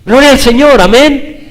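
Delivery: high, loud joyful shout; fervent praise. Real, not acted. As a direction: cry out praise to the Lord. Preacher exclaims praise. (exclamación jubilosa y fuerte)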